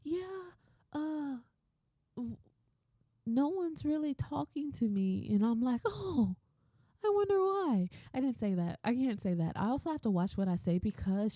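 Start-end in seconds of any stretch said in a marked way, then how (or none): 0.0-5.3 mimicked poor-me voice; mock woe-is-me coworker. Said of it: "she's trying to act like". 5.9-7.9 high-pitched singsong sarcasm; exaggeratedly puzzled retort; sarcastic mock wonder. Sarcastic unsaid retort to coworker.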